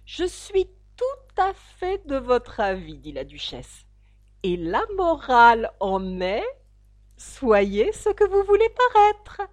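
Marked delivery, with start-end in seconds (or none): 0.1-3.0 put-on slow, higher, agreeable old duchess; lightly voiced duchess agreeing. 4.4-9.4 slow, high, louder moralizing duchess; pompous duchess. Acted.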